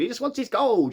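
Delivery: hint of a greedy leprechaun pining for gold; Warwick Davis leprechaun. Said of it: "that's the Warwick Davis voice".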